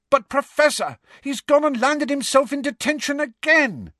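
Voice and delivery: high, loud protesting voice; Angelina protesting. A narrator's reading. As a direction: plead your case, loud and high.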